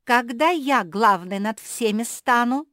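high, very loud interjection; interrupting. A narrator's reading. Beatrisa cuts into Basil's story, loudly interrupting. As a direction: burst in over the other speaker. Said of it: "перебила его Беатриса".

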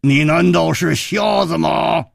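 slow, loud scolding shout; glaring. Acted. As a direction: snap at him wide-eyed. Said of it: "瞪着眼睛说".

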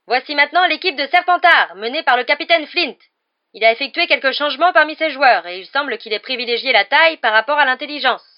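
loud, high stadium commentary; Lee Jordan commentating. A narrator's reading. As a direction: announce the rival team to the whole stadium. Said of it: "qui assurait le commentaire du match". (voix forte de commentateur sportif)

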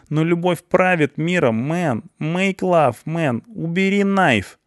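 high, rambling stoner pleading; goofy lovestruck guy begging; jittery slang guy. Acted. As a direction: talk the executioner down in a silly high voice. Slangy guy's voice.

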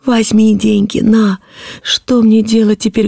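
put-on high, anguished little-girl voice; helpless childlike wail; horrified, pleading child. A narrator's reading. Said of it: "закричала я в полном ужасе".

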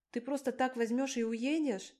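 high-pitched, put-on outrage; feigned indignation. A narrator's reading. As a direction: question him with mock indignation, voice lifted. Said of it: "Притворно возмутилась я".

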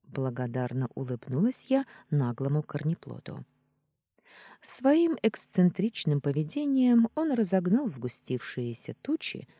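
plain reading voice

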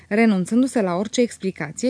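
ordinary delivery

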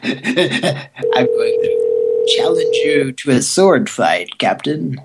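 slow, savoured mock-dramatic challenge; Mister Sulu. Challenging the Captain to a sword fight, theatrical impression. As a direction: draw the words out in a theatrical impression. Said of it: "Mister Sulu".

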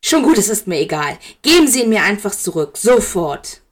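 loud, fast and insistent; angry demand. Acted. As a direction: demand it back angrily, at once. Severus demands it back angrily.

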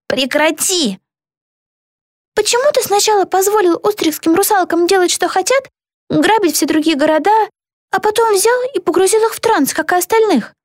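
high-pitched angry challenge; indignant demand. A narrator's reading. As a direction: confront him in a high, indignant voice. Zelda angrily confronts the villain. (возмущённо, высоким голосом)

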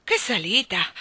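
puffed out after a climb; winded exclamation. A narrator's reading. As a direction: exclaim it, then pant. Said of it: "esclamò il re mettendosi a sedere".

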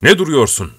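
put-on young master snaps at servant, loud and stern; stern shout. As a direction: bark it at the servant. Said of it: "Ben sert bir sesle".

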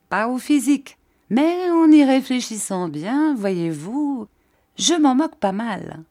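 high, lively, amused delivery; jovial. A narrator's reading. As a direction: brush it off merrily, pitch swinging high. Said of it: "dit monsieur Peggotty en riant".

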